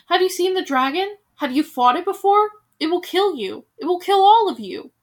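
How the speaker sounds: loud, rising dire warning; angry warning. Acted. Bald veteran warning villagers of the dragon, loud anger. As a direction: hammer home that it will kill them.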